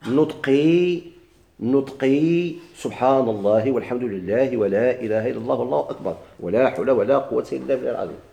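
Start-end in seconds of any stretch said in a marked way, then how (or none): none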